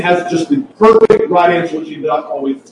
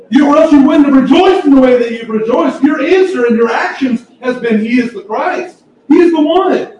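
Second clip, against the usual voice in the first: preaching at full force; 8 semitones higher than usual. Real, not acted. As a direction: lift them up with awe in your voice. Preacher rising to climax.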